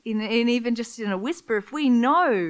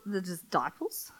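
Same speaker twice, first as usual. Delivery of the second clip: whisper; 2 semitones lower, 8 dB quieter than usual. Acted. Whispered aside in sermon.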